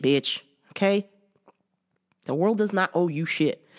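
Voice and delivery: ordinary delivery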